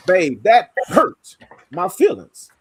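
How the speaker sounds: HURT hit loud and set apart; stress on HURT. Shown as a demonstration. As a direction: lean hard on HURT, then pause before "my feelings". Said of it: "but the inflection".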